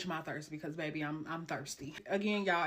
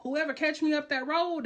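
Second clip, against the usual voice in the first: ordinary talk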